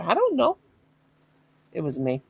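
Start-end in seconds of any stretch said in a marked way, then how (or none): 0.0-0.6 much higher, sing-song innocent voice; feigned innocence. Retold or imitated. Wife telling husband she doesn't know, mock innocent. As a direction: play dumb sweetly. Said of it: "I said".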